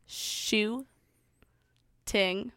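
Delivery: syllable by syllable. Shown as a demonstration. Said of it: "I'm gonna count it off".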